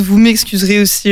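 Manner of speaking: ordinary talk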